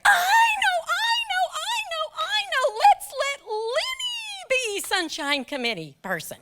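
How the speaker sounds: high-pitched, pushy, overeager shout; shrill fake-sweet office bully; eager coworker. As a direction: squeal it like a pushy coworker volunteering someone. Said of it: "this woman leapt out of her chair".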